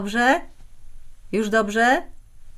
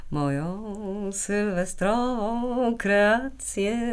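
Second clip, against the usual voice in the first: slow, soft, drawn-out purr; showing off; 8 dB quieter, much slower than usual. Acted. Wife unveiling her New Year's dress to her husband, showing off. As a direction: stretch it out softly, savoring each word.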